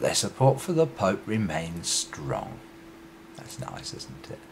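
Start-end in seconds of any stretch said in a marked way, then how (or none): 3.4-4.4 whispered sarcastic aside. Real, not acted. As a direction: breathe it out dryly.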